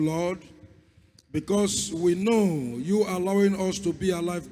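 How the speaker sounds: ordinary talk, nothing marked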